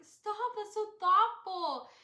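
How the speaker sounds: high, loud, drawn-out gush; touched protest. Retold or imitated. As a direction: gush it slowly, overwhelmed by kindness. Reenacts touched reaction to gift.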